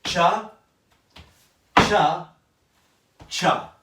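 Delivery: each cha called loud, spaced apart; spaced count. Shown as a demonstration. Teacher counting the backward steps, loud and spaced. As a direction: punch every cha with a pause. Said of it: "If we go backwards, I go".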